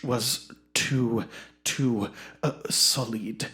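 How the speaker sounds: pompous Shakespearean actor. Acted. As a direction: savour every word like a self-important thespian.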